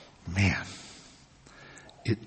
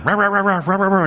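higher, barky mimic of two dogs; imitated dog barking; 7 semitones higher, 4 dB louder, faster than usual. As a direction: bark it like the dogs at the voice. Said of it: "I got the two dogs barking".

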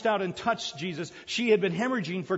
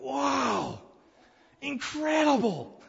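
high, slow, drawn-out wow; amazed; 6 semitones higher, much slower than usual. Retold or imitated. Preacher recalling reading the passage, awed surprise. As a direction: gasp it out, blown away. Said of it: "and I'm going".

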